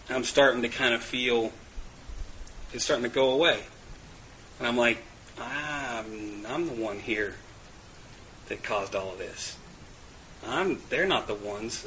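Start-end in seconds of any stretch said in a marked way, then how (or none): 5.4-11.9 soft, low inner admission; hushed, humbled self-talk; quiet realization. Retold or imitated. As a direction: say it softly to yourself.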